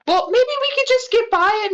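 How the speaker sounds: high, fast nervous suggestion; nervous rush. Real, not acted. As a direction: hurry it out, anxious.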